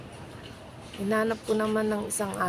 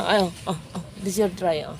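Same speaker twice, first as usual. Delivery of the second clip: ordinary talk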